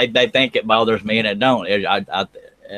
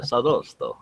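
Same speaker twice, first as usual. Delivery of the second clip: Sadosto in a put-on accent, softer; 5 dB quieter, slower than usual. Host explaining why he says Sadosto, put-on accent. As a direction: quietly slip into a mock accent for Sadosto. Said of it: "say it in that accent".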